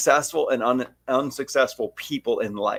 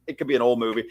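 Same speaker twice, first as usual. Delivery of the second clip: usual speaking voice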